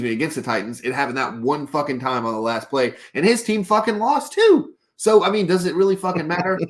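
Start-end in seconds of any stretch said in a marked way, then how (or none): 3.1-6.6 heated rant. Real, not acted. Podcast host ranting about the Chiefs' complaining quarterback, exasperated. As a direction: voice climbing high in real exasperation. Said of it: "That was my rant".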